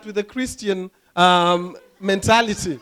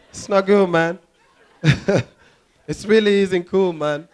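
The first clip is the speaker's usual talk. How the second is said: ordinary talk, nothing marked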